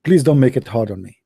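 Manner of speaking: ordinary delivery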